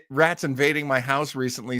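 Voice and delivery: ordinary delivery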